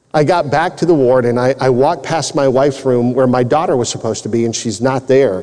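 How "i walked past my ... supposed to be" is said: usual speaking voice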